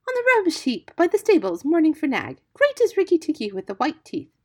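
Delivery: high, loud singsong bird voice; high-pitched feather-brained bird; exultant songbird. A narrator's reading. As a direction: gush the news like a giddy bird. Voices Darzee the silly bird.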